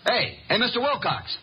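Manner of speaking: calling out high into the phone; shouting. Acted. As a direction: shout his name down the line. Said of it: "Archie, stop shouting".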